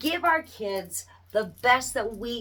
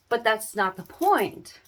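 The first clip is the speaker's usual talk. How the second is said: ordinary delivery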